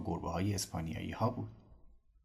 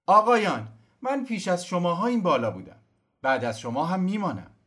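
high, loud firm reply; calling down; 10 semitones higher, 7 dB louder than usual. Acted. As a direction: answer proudly from above. Cosimo answers from tree.

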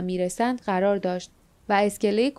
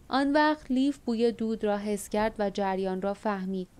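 usual speaking voice

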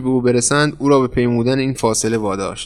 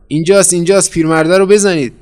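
raised, high cry to the gang; urgent shout; 6 semitones higher, 5 dB louder than usual. A narrator's reading. Pursuer calling the others onto the old man, shouting urgently. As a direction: sound the alarm and order the attack.

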